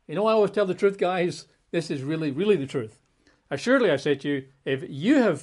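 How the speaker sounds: emphatic assurance to the disciples. Retold or imitated. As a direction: lean hard on the truth, guys. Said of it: "he's trying to be emphatic".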